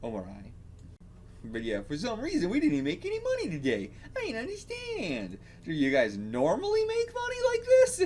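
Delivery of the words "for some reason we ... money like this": very high, complaining beggar character; put-on beggar voice. Beggar grumbling about making no money, put-on high voice. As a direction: pitch it way up for a baffled beggar.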